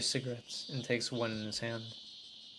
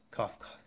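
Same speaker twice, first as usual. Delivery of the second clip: weak, strained hacking cough; abnormal cough. Acted. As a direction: cough like something is badly wrong. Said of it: "That cough doesn't sound normal".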